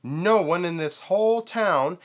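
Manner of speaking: high, loud shouting boast. A narrator's reading. Vulture shouts his vanity while jumping, high and loud. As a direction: yell your own praise. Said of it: "he shouted".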